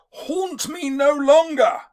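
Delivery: high, drawn-out anguished yell. Acted. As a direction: cry it out loud and high, stretching the words. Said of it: "he yells out".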